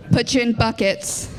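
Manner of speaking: ordinary talk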